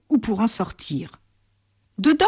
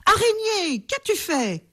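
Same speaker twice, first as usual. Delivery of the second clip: high, alarmed giant cry; 11 semitones higher than usual. A narrator's reading. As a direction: call out to the spider in dismay. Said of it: "s'écria-t-il".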